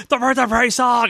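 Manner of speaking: high excited squeal of recognition; excited shout. Retold or imitated. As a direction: blurt it out like a thrilled fan. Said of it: "and I was like".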